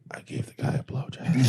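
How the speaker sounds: whispered, mock-sheepish confession; whispering, playing a guilty wife; hushed confession. Wife's confession in acted scene.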